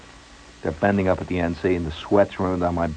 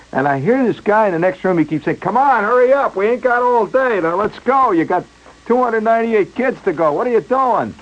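impatient photographer; 12 semitones higher than usual. Retold or imitated.

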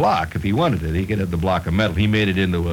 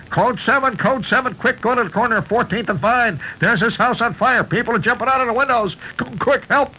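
frantic, much higher alarm call; urgent alarm call; 12 semitones higher than usual. Acted. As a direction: rouse the crew in a panic. Fireman calling an alarm.

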